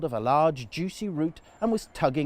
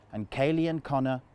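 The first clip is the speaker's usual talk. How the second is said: ordinary delivery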